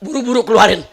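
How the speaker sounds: put-on loud, fed-up old jinn, rushing at the end; an old jinn who can't stand it anymore, laughing; fed-up jinn begs the husband to take his wife out; exasperated old jinn. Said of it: "Ini kakek bilang".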